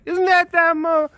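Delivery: high-pitched put-on voice of a townsperson; hammed-up villager gossiping about the boy; townspeople voice. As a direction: scoff in a high, silly character voice. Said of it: "people in the town were just like".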